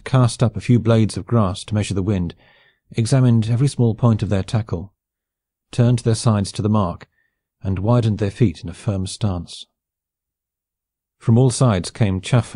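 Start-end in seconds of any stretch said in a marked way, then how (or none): none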